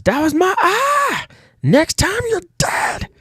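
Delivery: very high, slow, strained villain yell; pained villain rage. Acted. Villain shot in the eye yells a threat, high and slow. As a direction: scream it out after being shot in the eye.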